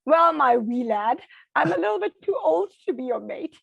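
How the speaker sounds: put-on amused old lady teasing a little boy; a hint of an old lady chuckling at a child; elderly lady. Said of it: "she burst into laughter and she says".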